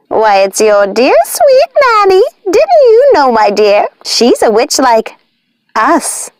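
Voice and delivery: high, slow gloating witch voice; high mocking witch cackle; cackled. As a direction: cackle out the secret like a hag. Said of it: "Martha cackled".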